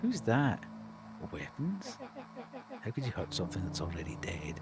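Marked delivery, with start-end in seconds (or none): 1.3-4.6 eerie monster voice. Acted. Monster figure in the dark.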